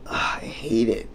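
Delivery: breathy whisper under your breath; hushed mock complaint; whispered groan. Real, not acted. Mock dismay at own ancestry.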